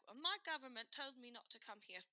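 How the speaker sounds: hammed-up defiant British holidaymaker; British accent. British tourist defending her holiday, in a mock British accent. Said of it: "that one British lady".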